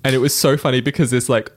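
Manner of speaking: ordinary delivery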